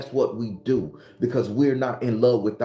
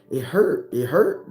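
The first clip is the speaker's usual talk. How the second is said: higher and louder, insisting; emphatic insistence; 6 semitones higher, 4 dB louder, slower than usual. Real, not acted. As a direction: push it out, it really hurt. Admits pain, insisting.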